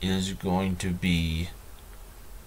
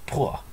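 mock spit; 5 semitones higher than usual. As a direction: pretend spitting noise, pitched up. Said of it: "And I spit in your face".

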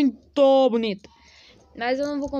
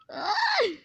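surprised yelp. Real, not acted. Sudden reaction to a skin.

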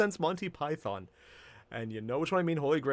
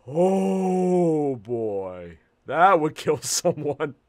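loud, long drawn-out groaning exclamation; amused dread; 8 dB louder, much slower than usual. Real, not acted. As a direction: stretch it out in loud, amused alarm. Dreading the drinking game.

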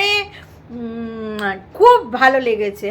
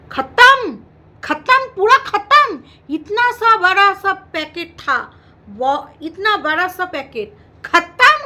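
mock outrage; 5 semitones higher, 5 dB louder, slower than usual. Mock Hindi outburst over empty packet.

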